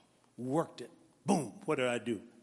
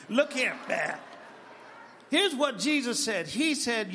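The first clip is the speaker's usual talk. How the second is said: ordinary talk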